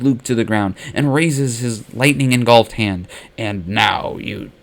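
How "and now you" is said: menacing villain. Acted. Emperor about to kill Luke.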